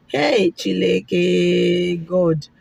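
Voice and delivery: a long, aghast call on God; drawn-out dismay. Real, not acted. Telling viewers of the doctor's price, drawn-out dismay. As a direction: stretch out the Hey, Chineke in dismay.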